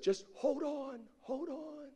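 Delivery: high and soft, fading away; acted faraway voice drifting up; mysterious distant voice. God to man on cliff, high soft fading call.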